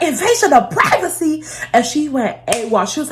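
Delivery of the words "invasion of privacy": high-pitched, outraged mock disbelief; incredulous echo. Mom echoing her daughter's privacy complaint, incredulous. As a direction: throw it back as an indignant question. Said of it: "she was going off on me".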